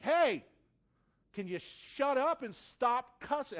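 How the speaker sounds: high, loud "hey" and pointed demand; irritated, high-pitched telling-off; rude. Retold or imitated. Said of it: "I was p-, kind of rude about it".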